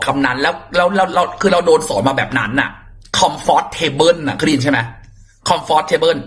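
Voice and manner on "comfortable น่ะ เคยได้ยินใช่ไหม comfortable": "comfortable" read as "comfort table"; mispronounced comfortable. Shown as a demonstration. Teacher showing students a common mistake, mispronounced "comfortable". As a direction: say "comfortable" as two words, comfort plus table. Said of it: "ไม่จ้า ออกเสียงว่า".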